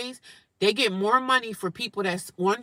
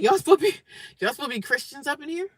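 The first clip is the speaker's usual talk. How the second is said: retold indignant call-out; 7 semitones higher, faster than usual. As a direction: call them out, voice jumping up. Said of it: "I said".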